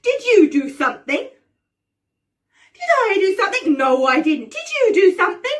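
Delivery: rising sibling squabble; escalating quarrel. Acted.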